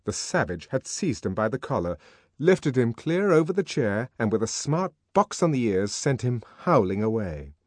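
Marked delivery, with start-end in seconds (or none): none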